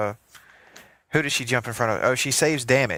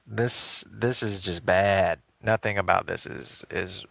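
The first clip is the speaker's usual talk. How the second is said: disgusted; 4 semitones lower, 4 dB quieter, slower than usual. Real, not acted. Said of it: "Oh God".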